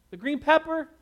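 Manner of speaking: high, loud eager question; a keen boy firing the next question; excited kid. Acted. Son asks mom about green pepper, high and keen.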